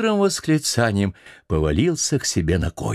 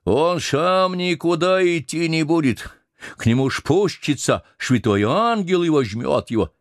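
put-on slow, higher solemn old cook; solemn old man; 9 semitones higher than usual. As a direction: let the old voice turn solemn. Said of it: "и самый голос вдруг изменились".

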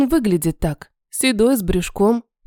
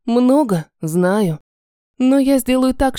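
ordinary delivery

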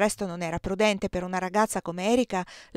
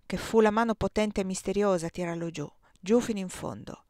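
ordinary delivery, nothing marked

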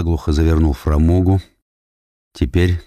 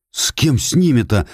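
pleading; 6 semitones higher than usual. Acted. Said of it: "взмолился Андрей Петрович".

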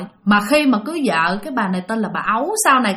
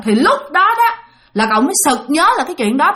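ordinary talk, nothing marked

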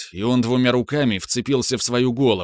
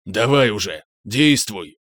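dark lord. Acted. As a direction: urge him on sternly. Monster urging.

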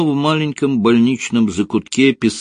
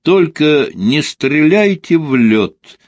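high, loud, slow call; 8 semitones higher, 5 dB louder, slower than usual. A narrator's reading. Konstantin shouts back to his pursuers as he flees, drawn-out call. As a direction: call out loudly, stretching the words. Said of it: "крикнул им Константин".

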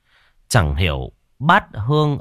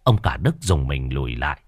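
plain reading voice

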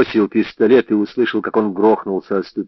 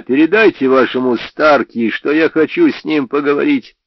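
shouted; 6 semitones higher, 4 dB louder than usual. A narrator's reading. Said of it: "закричал я".

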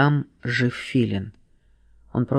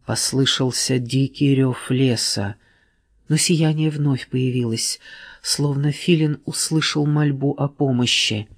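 plain reading voice

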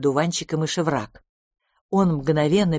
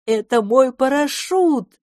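drawn-out, higher-pitched delight; joyful exclamation; 7 semitones higher, 4 dB louder, much slower than usual. A narrator's reading. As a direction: cry out in joy, stretching the words. Brown joyfully remembers, dances. (радостный возглас)

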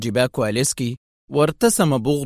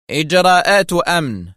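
louder, higher official reply; curt sternness; 5 semitones higher, 5 dB louder than usual. A narrator's reading. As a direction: answer briefly and severely. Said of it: "أجابه الضابط في صرامة مقتضبة".

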